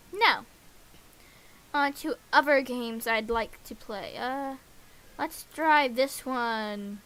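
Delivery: ordinary delivery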